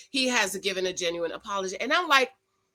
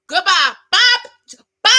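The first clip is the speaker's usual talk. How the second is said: dismissive; 7 semitones higher, 11 dB louder than usual. Retold or imitated. Wishes Ben dismissed her.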